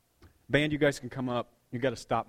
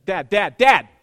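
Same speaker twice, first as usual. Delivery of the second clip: loud, rising calls; kid calling for dad; 3 semitones higher, 15 dB louder, slower than usual. Retold or imitated. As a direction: yell for dad's attention, louder each time. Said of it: "I heard him say".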